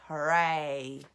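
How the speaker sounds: loud cheer; witches' cheer. A narrator's reading. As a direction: cheer in triumph. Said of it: "all us witches shout".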